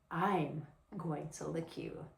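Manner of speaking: hushed and deliberate; slow, soft promise. Shown as a demonstration. Delivering the promise of those five words, slow and soft. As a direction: stretch it out softly, word by word. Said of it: "how you say it is of the essence".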